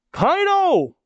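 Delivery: high-pitched drawn-out cry of a name. Acted. Reader reacting as Kaido gets hit, high cry. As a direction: call it out high, in alarm.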